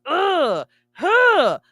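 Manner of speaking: high strained ugh then a gasp; a short exasperated ugh-huh; anxious groan. Retold or imitated. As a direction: groan like the paperwork hurts. Groan at signing paperwork.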